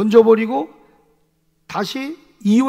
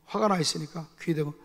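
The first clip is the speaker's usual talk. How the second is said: ordinary talk, nothing marked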